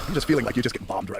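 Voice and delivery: normal talking voice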